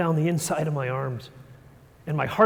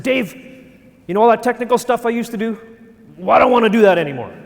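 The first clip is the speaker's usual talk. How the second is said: fast, loud and higher, all in one breath; nervous blurt; 5 semitones higher, 8 dB louder, faster than usual. Retold or imitated. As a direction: blurt it out before you lose your nerve. Nervous outburst to boss.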